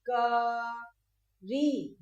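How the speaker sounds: बकरी with the final ई drawn out very slowly; बकरी, slow, long ई held; long ee in bakri. Shown as a demonstration. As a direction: stretch the long ई at the end of बकरी. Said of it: "ई की मात्रा का तो सबसे पहले था बकरी".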